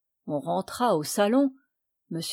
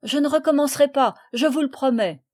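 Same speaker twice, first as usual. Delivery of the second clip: frightened begging; 6 semitones higher, 5 dB louder, faster than usual. A narrator's reading. Boy begs promising.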